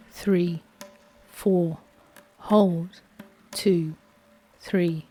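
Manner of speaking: usual speaking voice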